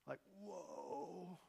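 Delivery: very soft, stunned whoa; hushed shock. Acted. Preacher reacting to the execution order, hushed shock. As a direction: let it out under the breath.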